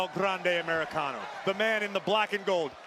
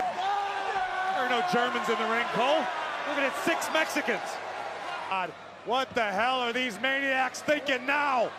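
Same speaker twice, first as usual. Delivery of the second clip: wrestling commentator; 4 semitones higher than usual. Acted. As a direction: hype the high flyers. Live wrestling play-by-play.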